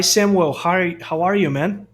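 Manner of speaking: ordinary talk, nothing marked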